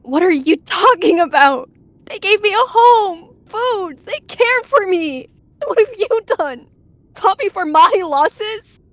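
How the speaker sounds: high, loud, drawn-out outrage; yelling back. A narrator's reading. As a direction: shout back in wounded fury. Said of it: "She yelled back".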